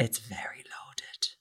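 hushed, slow whisper; whispered aside. Real, not acted. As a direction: drop to a slow conspiratorial whisper. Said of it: "whispered comment".

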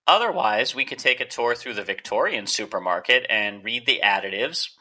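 ordinary delivery

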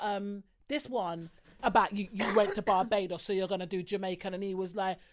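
usual speaking voice

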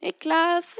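high call across the toilets; hailing a friend through a door. Retold or imitated. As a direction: call his name toward the stalls. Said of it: "Ropar typ lite så här".

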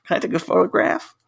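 high, slow, gushing tourist impression; mock American tourist. Imitating Americans on a British street, high gushing tourist voice. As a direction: coo it like a starstruck American sightseer. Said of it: "In America, though, they're like".

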